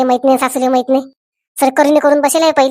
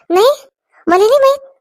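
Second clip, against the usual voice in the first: high-pitched surprise at unknown news; surprised; 10 semitones higher than usual. Acted. Sister learning of family news from husband, surprised. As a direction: caught unawares, voice leaping up high.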